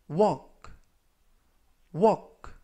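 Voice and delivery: walk said clearly with silent l, WOK; model pronunciation. Shown as a demonstration. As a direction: demonstrate walk for learners. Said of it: "라고 발음하시면 되겠고요". (walk 발음 시범, l은 묵음)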